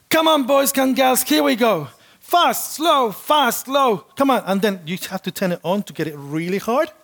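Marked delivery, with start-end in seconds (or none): 0.1-4.4 much higher, loud instructor calls over music; shouting instructions over pounding music; spin instructor shouting. Retold or imitated. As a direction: bark out the class like a fitness coach. Spin instructor voiced in story.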